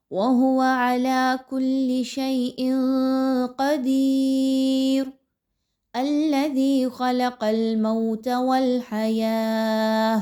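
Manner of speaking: slow chant, pausing after qadeer; recitation with a stop. Shown as a demonstration. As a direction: recite and stop at the verse end. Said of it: "اور اگر وقف کرتے ہیں تو". (وقف کے ساتھ تلاوت)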